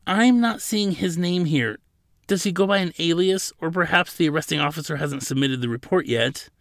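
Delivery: high-pitched, anxious apology; nervously helpful. A narrator's reading. Attendant searching records for a stern counsel, nervously helpful. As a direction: tentatively offer explanations, eager to appease. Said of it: "The man tried to be helpful".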